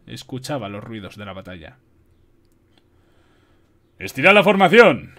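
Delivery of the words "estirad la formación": loud, raised battlefield command; general's loud command to the troops; commanding order. A narrator's reading. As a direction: order the officers, loud and high. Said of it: "ordenó a los jefes".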